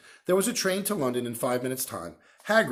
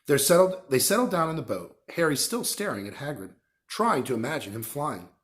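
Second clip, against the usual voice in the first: usual speaking voice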